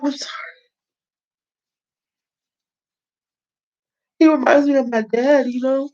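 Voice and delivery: high, tearful, choked apology; real crying. Real, not acted. Apologizing to viewers while crying over her dad. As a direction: voice breaking as she cries. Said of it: "I am not gonna cry".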